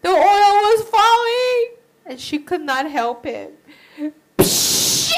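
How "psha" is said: loud unvoiced sizzle of burning oil; hissing sizzle. Boiling oil hits arm.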